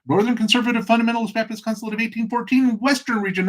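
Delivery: very high, fast recital; rapid-fire answer. Acted. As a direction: build tension by racing through the title. Rattles off absurd denomination name.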